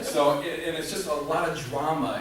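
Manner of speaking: ordinary talk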